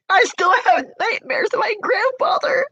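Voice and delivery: high, tearful voice; distressed crying speech. Real, not acted. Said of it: "I'm here right now crying".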